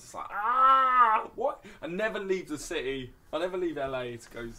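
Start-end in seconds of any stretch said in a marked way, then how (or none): 0.3-3.8 high, loud exaggerated "what?"; hammed-up squeal of disbelief; mock disbelief. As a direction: play up your own shocked reaction. Retelling his own shocked reaction.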